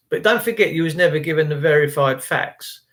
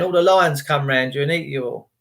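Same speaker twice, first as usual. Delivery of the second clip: ordinary talk, nothing marked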